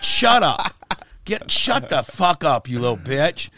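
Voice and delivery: loud, slowed shout of contempt; retold yell. The on-air outburst that got him banned, loud yell. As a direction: yell her down, slowly. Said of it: "Well, whatever the fucking bitch".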